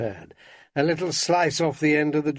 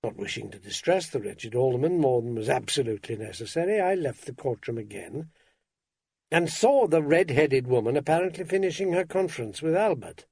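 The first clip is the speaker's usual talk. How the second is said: ordinary delivery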